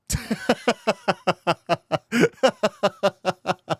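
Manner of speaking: high, extended giggling run; helpless high-pitched laughter; long laughing fit. Real, not acted. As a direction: crack up and keep laughing. Cracking up at the joke.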